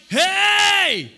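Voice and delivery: sudden loud alarm call; shout. Retold or imitated. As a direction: bark it like an alarm going off. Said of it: "So he's like".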